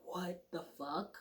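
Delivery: quiet, numb curse; hushed shock in the car; muttered disbelief. Retold or imitated. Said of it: "in complete".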